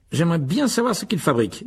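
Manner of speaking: frowning, wondering aloud; lightly voiced Fred. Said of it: "en fronçant les sourcils". (Fred intrigué, voix un peu plus haute)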